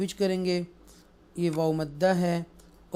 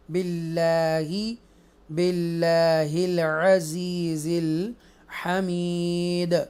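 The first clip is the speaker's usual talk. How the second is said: slow chanted tajweed reading; measured chanted recitation; Quranic recitation; much slower than usual. Shown as a demonstration. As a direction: recite it slowly and melodically. Recites verse in lesson.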